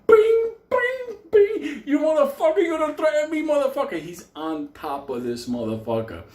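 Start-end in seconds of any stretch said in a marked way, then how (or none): 0.0-1.8 loud, high-pitched ringing bings; imitated bell clang. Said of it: "like, a fucking bell sound". 1.8-4.0 high-pitched raging threat; acted fury. Acting out Benny attacking the devil.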